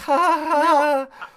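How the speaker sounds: forced nervous laughing; uncomfortable laugh. Retold or imitated. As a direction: laugh awkwardly, then a scared aside. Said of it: "that was my uncomfortable laugh".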